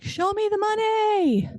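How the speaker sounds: very high, drawn-out mock holler; movie catchphrase cry. Kids demanding cash from their parent, high mock holler. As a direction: sing out the catchphrase, stretched and high.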